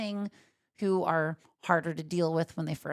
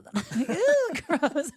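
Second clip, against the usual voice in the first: laughing disgust; 10 semitones higher, 5 dB louder, slower than usual. Real, not acted. Reacts to saliva, laughing.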